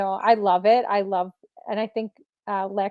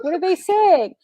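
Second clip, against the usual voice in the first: high-pitched, louder puzzled question; acted bewilderment; 9 semitones higher, 6 dB louder than usual. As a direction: re-enact being baffled, pitch jumping up. Retelling her puzzled question.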